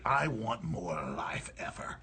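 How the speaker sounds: low, quiet, slow dramatic line; Roy Batty line. Retold or imitated. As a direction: deliver it like a dying replicant. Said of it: "like Roy Batty".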